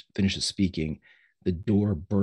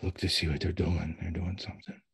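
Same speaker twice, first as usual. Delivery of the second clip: confused father, soft and secretive; whispering; 2 semitones lower, 8 dB quieter than usual. Acted. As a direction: murmur a secret warning to your son. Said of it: "Eugene whispering".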